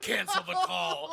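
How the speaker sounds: mock cowboy cool; a hint of John Wayne; John Wayne tough guy. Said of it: "John Wayne ass looks at me and goes".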